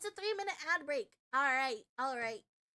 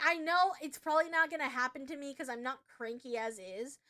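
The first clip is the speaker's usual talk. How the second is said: usual speaking voice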